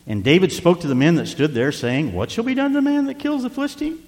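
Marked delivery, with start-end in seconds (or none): 2.1-4.0 eager question. Retold or imitated. David's question voiced high.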